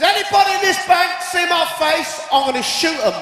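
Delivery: hammed-up bank robber yelling; robber's threat. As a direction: bellow it at the whole bank.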